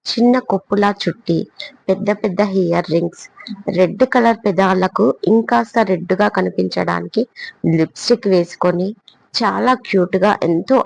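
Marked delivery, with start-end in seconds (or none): none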